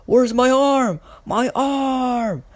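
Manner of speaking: high, loud acted scream; screaming in panic. Boy finds his arm gone, screaming in panic. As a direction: cry out in horror, pitch way up. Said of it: "he starts screaming".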